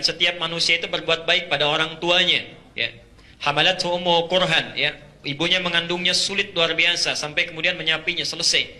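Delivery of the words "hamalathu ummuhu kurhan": chanted Arabic verse, full voice; Quran recitation. Acted. Preacher reciting Quran to congregation, chanted.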